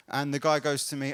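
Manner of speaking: normal talking voice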